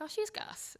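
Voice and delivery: high, bright-eyed mock praise; chirpy audience quote. Friends back home gushing about her, high mock delight. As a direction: toss it off like a delighted fan. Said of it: "they're like".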